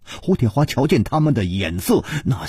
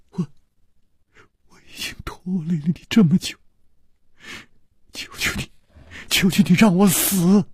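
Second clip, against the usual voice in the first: breathy, faltering, near-whispered begging; tearful plea. A narrator's reading. Liu Wumei begs her husband to let her die, tearful and faint. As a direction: plead through tears with long broken pauses. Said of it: "泪流满面".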